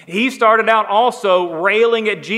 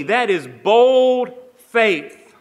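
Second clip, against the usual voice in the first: bold stretched out, very slow and louder; slow emphasis; 3 semitones higher, 3 dB louder, much slower than usual. Real, not acted. Preacher driving home the criminal's faith, slow and emphatic. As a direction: lean hard into bold, drawing it out.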